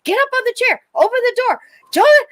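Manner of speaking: high, loud barked commands, climbing to a shriek; mother yelling orders. Retold or imitated. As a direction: yell the orders in a panic. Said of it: "So she's like".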